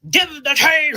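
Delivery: high, loud mimicking scream; mock battle cry. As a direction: scream it out, imitating their big moment. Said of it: "You thought you was doing your".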